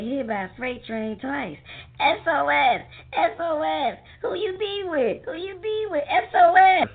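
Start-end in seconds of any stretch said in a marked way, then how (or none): 2.0-6.9 yelling for help. Acted. Calling distress signal.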